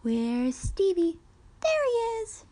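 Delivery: slow question, then high loud reveal; enthusiastic peekaboo. A narrator's reading. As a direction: play peekaboo with a baby, leaping up in pitch. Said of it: "enthusiastically tried to play peekaboo".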